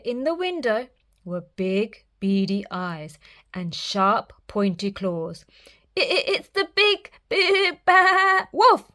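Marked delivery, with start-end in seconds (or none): 6.0-8.9 high, stammering, scared piglet; teeth-chattering fear. Acted. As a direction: stutter it out trembling. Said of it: "Boris chattered".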